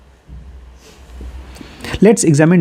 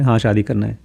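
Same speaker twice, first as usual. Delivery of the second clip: lower, softer obedient reply; quietly compliant; 5 semitones lower, 5 dB quieter than usual. Retold or imitated. Son obeys his father without question, quietly compliant. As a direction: give in to father with a low, mild yes. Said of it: "चुपचाप मैं उसको follow करता था".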